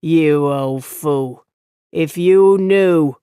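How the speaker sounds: slow, loud contemptuous rebuke; scornful scolding. Acted. Innkeeper berating the old farmer, slow and loud. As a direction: drag out the scorn, then bite down.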